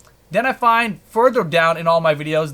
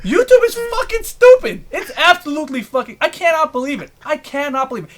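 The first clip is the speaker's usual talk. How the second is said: furious disbelief; 8 semitones higher than usual. Real, not acted. Creator raging about YouTube flagging his videos, outraged. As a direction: spit out the disbelief.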